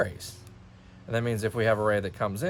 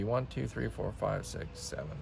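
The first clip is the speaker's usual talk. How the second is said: soft count under the breath; counting to self; 9 dB quieter than usual. Real, not acted. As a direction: count quietly as you work it out. Counting grid units to self.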